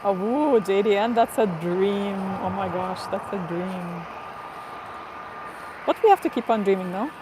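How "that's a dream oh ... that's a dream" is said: ordinary talk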